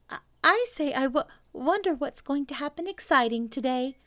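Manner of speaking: high, stammering little animal; eager small creature tripping over words; Piglet voice. A narrator's reading. Piglet answers Pooh.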